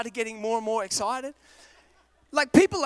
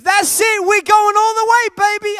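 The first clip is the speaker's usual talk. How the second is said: fan shouting; 8 semitones higher, 11 dB louder than usual. Retold or imitated.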